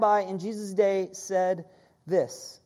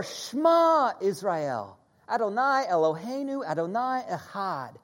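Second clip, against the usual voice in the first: prayer recital. Acted.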